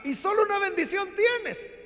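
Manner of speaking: ruego lloroso, mucho más agudo y más fuerte; Esaú llorando. Retold or imitated. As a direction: suplica con voz quebrada. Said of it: "Esaú sigue llorando y le dice". (Esaú llorando pregunta a su padre si solo tiene una bendición)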